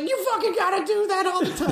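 very high imitation of a delighted friend; mock excited demand. Acting out Chloe's laughing demand.

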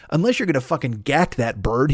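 ordinary delivery

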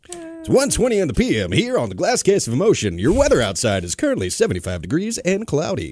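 high, bouncy mock radio DJ voice; radio announcer. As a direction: read the time and weather like a cheesy DJ. Mock radio announcer time check.

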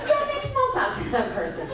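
high-pitched exasperated plea; scold, starting high and falling. Acted. Comic scolding a clueless small talker, exasperated.

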